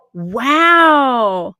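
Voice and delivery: a loud, drawn-out wow; impressed wow. Real, not acted. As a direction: sound really impressed. Reacts to a comment.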